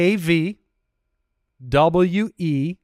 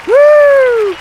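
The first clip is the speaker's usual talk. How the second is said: excited whoop; 11 dB louder than usual. Real, not acted. Cheering own success.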